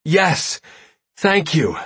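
high, joyful shout of relief. Acted. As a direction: cry out with sheer relief. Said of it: "literally shout for joy".

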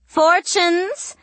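very high sing-song call. A narrator's reading. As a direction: cry it out over the crowd. Witch calls to crowd.

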